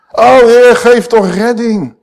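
high, loud, slow plea; fervent prayer. Acted. The preacher prays the psalm for rescue, high, loud and slow. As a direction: cry the plea out to God.

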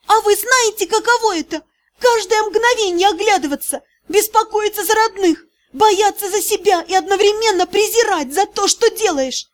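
high, tearful hysterical cries; girl in hysterics with squealing notes. A narrator's reading. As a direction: let hysteria take over. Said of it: "зашлась в истерике".

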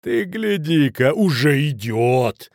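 slow, drawn-out jeer; mocking. A narrator's reading. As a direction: taunt him with feigned amazement. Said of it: "насмешливо повернулся Шмидт". (насмешливо, нараспев)

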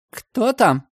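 high frightened voice behind a door. A narrator's reading. Woman behind the door, frightened of the police. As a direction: ask timidly, afraid of who's knocking. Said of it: "испуганно спросили у него".

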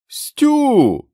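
very high, loud cry of recognition; joyful shout. A narrator's reading. The sentry recognises Stu and shouts his name, very high and loud. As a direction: yell his name in disbelief. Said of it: "крикнув вартовий".

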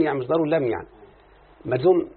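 usual speaking voice

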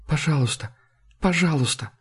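small, soft begging; subdued, helpless plea; pleading. A narrator's reading. Jude begs not to tell.

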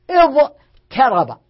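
اضطرب drawn out slowly, each sound clear; slow pronunciation demo. Shown as a demonstration. Grammar teacher to class, slow pronunciation demo. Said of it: "لكن ما تقال".

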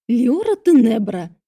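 slow, loud hiss of a name. A narrator's reading. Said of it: "прошипела мачеха".

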